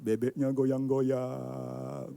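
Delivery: very slow, long-held last syllable; savouring temptation. Acted. Speaker describing roast ducks in the display case, savouring temptation. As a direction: draw "goyang-goyang" out as if the ducks dangle temptingly.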